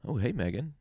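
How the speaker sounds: normal talking voice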